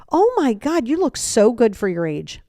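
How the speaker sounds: high, gushing "Oh my God" into false praise; backhanded compliment. Shown as a demonstration. Friend complimenting someone's looks with a sting, falsely gushing. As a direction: gush it with exaggerated admiration. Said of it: "Backhanded compliments".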